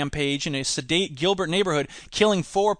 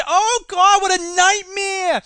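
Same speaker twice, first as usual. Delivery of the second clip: much higher, slow fake crying; mock sobbing wail; 12 semitones higher, 4 dB louder, slower than usual. Mocking the apology sanction, hammed-up crying. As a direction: wail like it's a tragedy.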